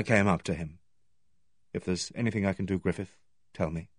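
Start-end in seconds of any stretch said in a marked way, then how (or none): 1.7-3.9 soft, low, quick murmur; gentle offer. A narrator's reading. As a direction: offer help softly and tactfully. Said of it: "I suggested feebly".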